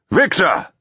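high blaring shout; blared. A narrator's reading. Voice from the manor recognising Victor, blaring. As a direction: blare the name across the water. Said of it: "the voice blared".

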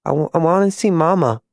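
put-on high, stammering little-girl plea; small high voice, close to crying; near-tears child. A narrator's reading. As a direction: plead for mother, about to cry. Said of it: "Her bottom lip began to quiver".

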